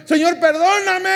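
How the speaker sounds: high, loud mock plea for forgiveness; pleading cry. Preacher mimicking a weeping believer's plea, high and loud. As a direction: cry out to the Lord as a show. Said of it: "llorando, clamando".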